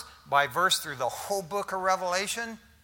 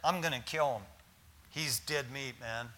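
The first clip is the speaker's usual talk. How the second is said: low menacing threat; 6 semitones lower, 9 dB quieter than usual. Retold or imitated. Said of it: "She says".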